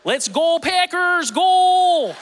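loud, drawn-out, high Wisconsin cheer; exaggerated Northern accent. Packers fan cheering to fit in, exaggerated Northern accent. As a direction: shout it with hammed-up Northern vowels. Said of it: "Like, I talk like that".

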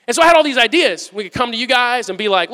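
ordinary talk

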